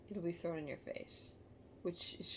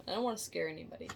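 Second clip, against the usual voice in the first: ordinary talk, nothing marked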